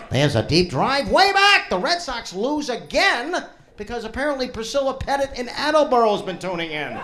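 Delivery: mock radio broadcaster joking that mom jinxes the Sox; mock sports announcer. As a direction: call it like a play-by-play announcer over the crowd. Mock baseball broadcast call.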